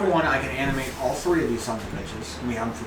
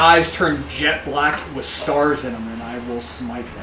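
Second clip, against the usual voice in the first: menacing; 7 dB louder, slower than usual. Acted. Dramatic threat.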